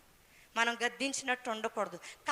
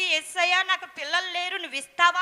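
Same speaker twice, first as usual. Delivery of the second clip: a higher, louder imagined cry to Jesus; 5 semitones higher, 5 dB louder than usual. Retold or imitated. Hannah imagined crying out to Jesus for a child, loud and high. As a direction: call out to God loudly for a child. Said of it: "గట్టిగా అరిచి".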